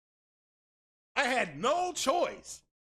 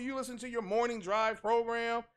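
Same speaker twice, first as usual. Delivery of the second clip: ordinary talk, nothing marked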